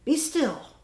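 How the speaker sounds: high, loud firm command. Acted. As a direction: order the monsters to freeze. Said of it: "Till Max said".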